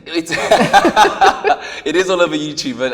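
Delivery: loud, high laughing through the words. Real, not acted. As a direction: laugh out the admission. Laughs at the YouTube remark.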